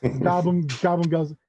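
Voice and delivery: ordinary talk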